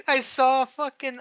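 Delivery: normal talking voice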